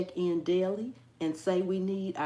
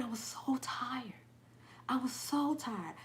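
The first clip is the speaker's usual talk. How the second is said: tired whisper; 3 semitones higher, 7 dB quieter than usual. Real, not acted. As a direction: whisper it, worn out. Stressing how tired she was.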